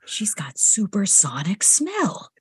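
slow panicked whisper. Retold or imitated. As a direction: whisper it in alarm, hiding it. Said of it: "I'm like".

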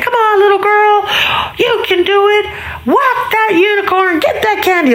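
put-on very high, urging kid's voice; cheering little boy. Little boy cheering on the little girl, high excited urging. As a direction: egg them on in a high child voice. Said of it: "So the little boy looked over there".

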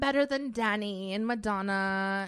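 slow, hammed-up boasting; cocky contestant. Contestant answering the judge, in a mock self-important voice. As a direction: brag like a deluded auditionee, drawing it out. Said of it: "And she comes".

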